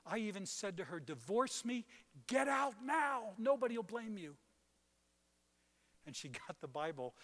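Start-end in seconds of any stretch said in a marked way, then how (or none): 1.0-4.3 high, loud urging to leave; pleading urge. Retold or imitated. Husband urging his wife to leave him, loud and high. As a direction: push her to go, voice rising high.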